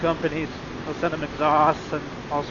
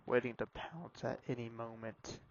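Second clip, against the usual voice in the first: spooky low whisper; hushed, eerie whisper; 6 semitones lower, 18 dB quieter than usual. Pretend. Spooky whisper on dark road.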